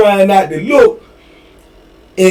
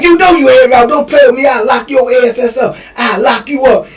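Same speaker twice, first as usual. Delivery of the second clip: threatening shout; 7 semitones higher than usual. Retold or imitated. CO threatening to lock him up.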